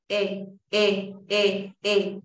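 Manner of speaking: "E" as the short, loose vowel of "sit". Shown as a demonstration. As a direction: say each "E" brief, between Spanish i and e. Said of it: "Esta es una I corta".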